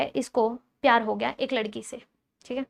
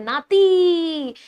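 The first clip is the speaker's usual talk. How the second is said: नाती drawn out very long, higher and louder; triumphant answer; 5 semitones higher, 6 dB louder, much slower than usual. Real, not acted. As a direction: land the found word नाती with a long stretch. Teacher lands on the answer. (नाती को लंबा खींचकर, ऊँचे ज़ोर से)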